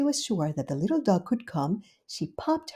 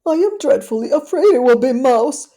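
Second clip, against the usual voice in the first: higher, louder, fretful worry; dreadfully afraid; 6 semitones higher, 9 dB louder than usual. Acted. Duchess fretting to herself, louder and worried. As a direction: wail it in dismay. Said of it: "said Duchess to herself".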